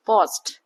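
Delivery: पॉस्ट ending in a clear t sound; पॉस्ट with the final t sounded; पॉस्ट said slowly for the class; pronunciation demo. Shown as a demonstration. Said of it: "last में लगता है टी बोल रहे हैं".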